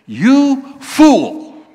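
loud, high, cutting rebuke; God the Father loudly rebuking the rich man; harsh loud rebuke. Shown as a demonstration. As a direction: bark it like a scolding father. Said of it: "that doesn't sound very nice".

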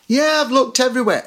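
put-on high-pitched, protesting kid voice; kid calling back. Kid answering Mum's question, high put-on protest. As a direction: protest upward in pitch, like a child to Mum. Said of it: "they shout down".

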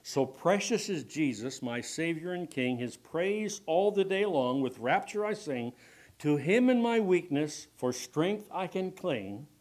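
hymn verse preached out loud; reciting a hymn. Acted. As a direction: declaim the hymn lines with fervor. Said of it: "someone penned these words".

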